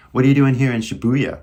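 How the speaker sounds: quick, loud casual Californian; familiar California accent. Shown as a demonstration. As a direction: call it out fast in California American English. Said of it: "use a familiar accent".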